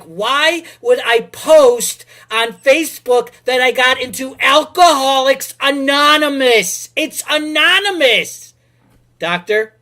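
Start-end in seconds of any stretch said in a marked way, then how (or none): 0.0-8.5 much higher and louder, slow and indignant; exasperated outburst. Retold or imitated. To Facebook friends, an exasperated loud protest. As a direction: spell it out in disbelief, loud.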